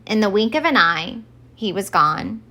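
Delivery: ordinary delivery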